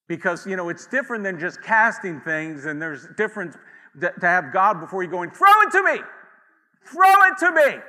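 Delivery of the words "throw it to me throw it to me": high, loud shout to catch; calling out loud. Pretend. God calling to the believer, loud eager shout. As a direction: call out eagerly, arms open.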